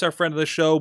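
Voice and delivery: usual speaking voice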